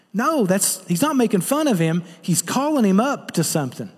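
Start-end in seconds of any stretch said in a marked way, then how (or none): none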